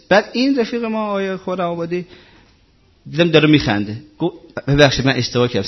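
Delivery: ordinary talk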